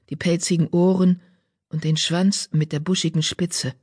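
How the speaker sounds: ordinary delivery, nothing marked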